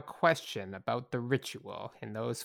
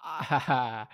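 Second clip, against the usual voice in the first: ordinary talk, nothing marked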